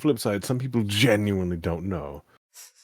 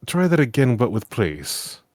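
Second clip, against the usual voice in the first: loud, sarcastic comeback, slowing at the end; sarcastic retort; 3 semitones higher, 7 dB louder than usual. Retold or imitated. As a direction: put a rude player in his place. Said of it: "And I'm like".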